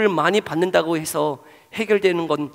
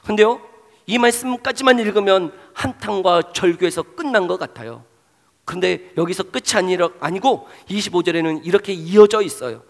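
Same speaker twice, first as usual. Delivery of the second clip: ordinary talk, nothing marked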